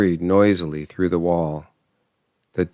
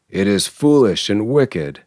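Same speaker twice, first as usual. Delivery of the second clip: plain reading voice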